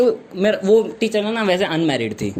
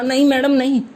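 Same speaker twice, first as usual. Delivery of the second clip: louder higher protest; 7 semitones higher, 4 dB louder than usual. Retold or imitated. Boy refuses sniffing.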